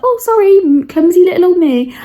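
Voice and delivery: high, loud, sing-song fake innocence; mock innocent. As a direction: play the sweet clumsy girl, over the top. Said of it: "I was like".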